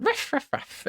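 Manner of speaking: high, soft little puppy yips; hushed high puppy barking; soft high puppy barks. Pretend. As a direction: yip softly like a sleepy pup.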